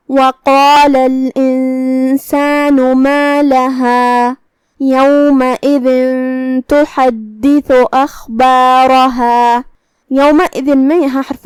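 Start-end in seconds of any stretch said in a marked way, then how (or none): 0.1-9.6 very slow tajweed with held nasal ghunnah; Quran recitation. Shown as a demonstration. Teacher reciting verses after the ikhfa rule, slow Quran recitation. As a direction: recite with long, steady vowels. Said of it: "غنہ کے ساتھ یہ حکم کو ادا کریں گے".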